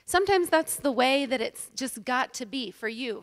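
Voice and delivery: ordinary delivery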